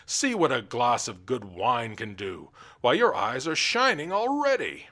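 loud, mocking royal voice; taunting king. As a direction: jeer at the weeping jester.

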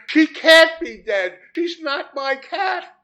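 very high, drawn-out cry of protest; blurted out loudly. A narrator's reading. Man protesting to the vet, high loud blurt. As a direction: wail it in disbelief, slowly. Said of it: "And I blurted out".

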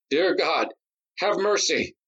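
high, desperate plea for mercy; pleading. Acted. Nadi on his knees begs for mercy, high and desperate. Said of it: "Nadi pleaded".

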